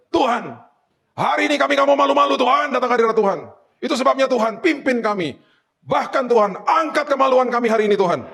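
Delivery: high, fervent opening prayer; imitated high-pitched rallying prayer; worship leader. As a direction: call out to God like an eager worship leader. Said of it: "worship leader. Dia mulai ibadah".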